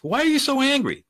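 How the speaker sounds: loud, high-pitched challenge; exasperated demanding question. Shown as a demonstration. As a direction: demand it, badly worked up. Demonstrates the wrong, confrontational question.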